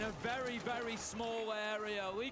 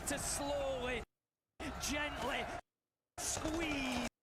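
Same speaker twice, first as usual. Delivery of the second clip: slow, drawn-out commentary; caster impression; 3 semitones higher, much slower than usual. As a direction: draw it out, caster style. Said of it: "I really like that".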